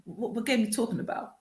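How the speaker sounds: ordinary talk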